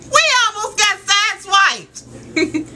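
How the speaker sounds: high, drawn-out dismay; alarmed exclamation. Retold or imitated. Daughter telling of almost being sideswiped, alarmed exclamation. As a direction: exclaim it as a near miss. Alarmed report of near accident.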